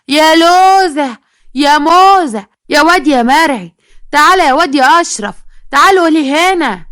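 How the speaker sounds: put-on high, drawn-out hollering for the children; calling at full voice. A narrator's reading. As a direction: summon each one with a long, piercing-pitched call. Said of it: "وندهت بقلب صوتها على بناتها". (نداء بأعلى الصوت)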